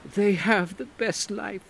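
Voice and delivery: high, slow, wistful woman's voice; wistful Angelique. Acted. Angelique musing to the narrator in the garden, wistful. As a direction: say it with a new softness. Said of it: "a new note in her voice".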